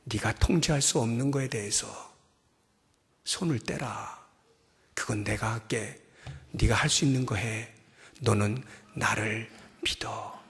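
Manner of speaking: soft, low, reassuring; tender voice of God. Acted. God telling the prophet to let go, soft and tender. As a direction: speak gently, like a father calming a child. God's gentle instruction.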